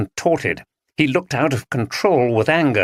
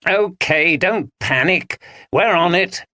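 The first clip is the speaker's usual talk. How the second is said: contemptuous; 7 semitones higher than usual. Acted. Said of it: "said Dominic contemptuously".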